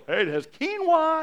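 high, loud imitation of a health-food fan; mock enthusiasm. Imitating quinoa people recommending it, mock excited. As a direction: gush it loudly, high and overdone.

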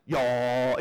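a lightly drawled Southern "Y'all". Shown as a demonstration. Pastor joking about slow speech, mock Southern drawl. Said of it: "speak in a southern drawl".

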